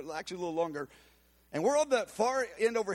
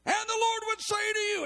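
much higher and louder, fiery prophetic call; zealous prophetess; 4 dB louder than usual. Retold or imitated. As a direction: proclaim it with fire like the old lady. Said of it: "with a, a zeal and a energy".

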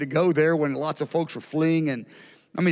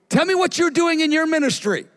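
high, keen request in a pastor's mouth; retold eager curiosity; 11 semitones higher, 4 dB louder than usual. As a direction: press eagerly to hear more. Pastor eagerly questions the speaker.

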